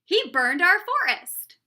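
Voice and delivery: loud, high accusation; indignant king shouting the charge; crying out. A narrator's reading. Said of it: "he cried".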